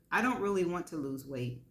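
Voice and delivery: ordinary delivery, nothing marked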